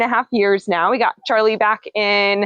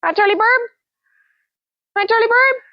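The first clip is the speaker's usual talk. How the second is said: much higher, sing-song greeting to a bird; pet talk; 11 semitones higher than usual. Real, not acted. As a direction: coo hello to the parrot in a high voice.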